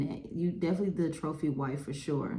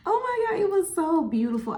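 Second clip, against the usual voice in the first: gasped, much higher squeal; excited; 13 semitones higher, 4 dB louder than usual. Retold or imitated. Reacting to the fan's words with a high delighted squeal. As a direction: squeal it like you just got recognized. Gasp then reaction to fan.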